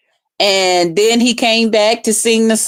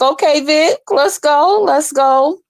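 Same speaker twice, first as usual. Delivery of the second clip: higher, rising let's go; hype cheer; 6 semitones higher, slower than usual. Retold or imitated. Cheering Vic as he sings, higher and rising. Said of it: "I was like".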